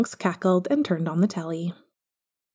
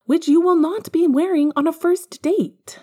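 excited; 10 semitones higher, 5 dB louder than usual. Acted. Tonks playfully vetoing cardigan.

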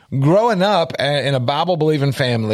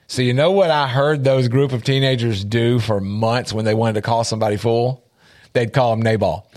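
ordinary talk, nothing marked

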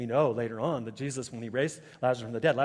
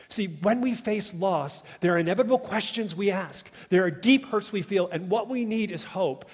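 preaching at full force; 5 semitones higher, 8 dB louder than usual. Acted. Preacher to the congregation, full-force preaching. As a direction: drive home the hope to the grieving.

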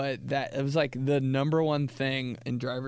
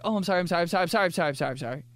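frantic apologizing; 5 semitones higher, much faster than usual. Retold or imitated. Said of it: "You're like".

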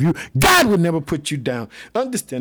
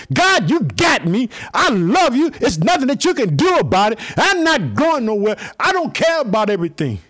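high, loud, slowed declaration to God; Job's vow; 8 semitones higher, 4 dB louder than usual. Pretend. Preacher praying to God like Job, loud earnest vow. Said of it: "I'm just like Job".

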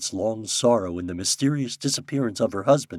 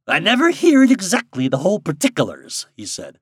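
put-on high twangy old woodsman voice; old backwoods guide; 5 semitones higher, 6 dB louder than usual. Ben tells it.

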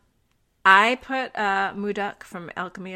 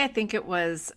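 ordinary delivery

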